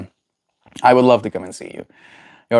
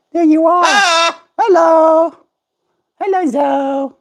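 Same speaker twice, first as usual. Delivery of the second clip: very high slow squeaky greeting; talking to a pet bird; 4 dB louder, slower than usual. Real, not acted.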